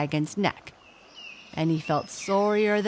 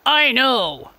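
loud, higher gruff reply; gorilla voice; 7 semitones higher, 8 dB louder than usual. A narrator's reading. Gorilla to the monkeys, loud and gruff. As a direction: brush them off. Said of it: "said the gorilla".

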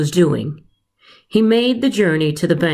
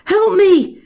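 high, loud, panicked cries; yelling for help; 4 dB louder than usual. Acted. Snake calling Patrick while the eagle carries him off, high yell. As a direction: scream for rescue as the talons close. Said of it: "he yelled".

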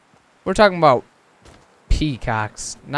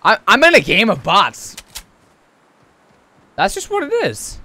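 excited; 7 semitones higher, 8 dB louder than usual. Real, not acted. Gamer reacting to bot lobby.

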